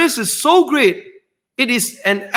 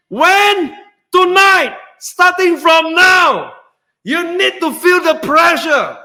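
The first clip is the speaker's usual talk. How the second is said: higher, louder, and the "Starting from now" slowed; urgent shout; 6 semitones higher, 3 dB louder, slower than usual. Real, not acted. Host urging viewers to join the webinar tonight, shouting. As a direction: push them hard to act tonight.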